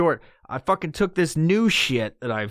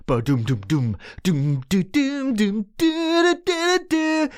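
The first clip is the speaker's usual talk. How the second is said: heartbeat imitation rising; 7 semitones higher than usual.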